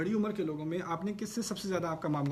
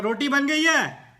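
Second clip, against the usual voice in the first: loud, higher, slow shout; shouting to be heard; 9 semitones higher, 12 dB louder, slower than usual. Retold or imitated. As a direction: yell it to a hard-of-hearing elder. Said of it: "تو آپ کو ذرا اونچی آواز میں پھر کہا". (اونچی آواز میں چلا کر)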